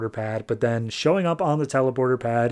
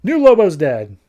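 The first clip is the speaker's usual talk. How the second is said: loud, high, gleeful announcement; happily declaring good riddance; real happy relief; 6 semitones higher, 7 dB louder than usual. Real, not acted. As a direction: crow it with delight. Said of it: "I'm so happy about it".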